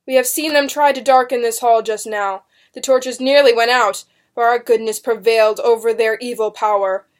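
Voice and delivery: loud, forceful courtroom oratory; public announcement. A narrator's reading. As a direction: proclaim it to the onlookers. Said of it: "announced to the rows of onlookers".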